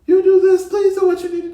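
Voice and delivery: high, strained pleading through tears, imitated; retold crying plea. To her brother on the phone, a high imitated crying plea. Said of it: "I'm on the phone crying".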